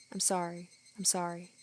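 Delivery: a faint ghostly apology; soft apology. Acted. Oyashiro-sama apologizing endlessly.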